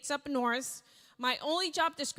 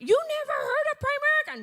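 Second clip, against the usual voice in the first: much higher and louder, fast, in disbelief; incredulous colleague; 14 semitones higher, 8 dB louder, faster than usual. Retold or imitated. Colleague in the cafeteria can't believe she never heard of Primerica.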